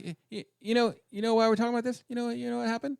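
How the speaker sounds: high, stammering smug voice; stammering lawyer. Retold or imitated. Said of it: "he's basically like".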